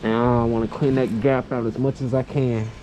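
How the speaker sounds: muffled behind a mask; talking through a face mask. Real, not acted. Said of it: "I'm talking through the mask".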